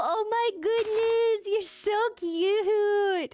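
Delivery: very high, loud delighted squeal with gasps; excited squealing. Real, not acted. Gamer spots the new piggies at last, squealing with excitement. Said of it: "screaming, crying, flowing up".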